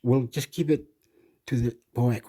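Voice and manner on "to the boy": normal talking voice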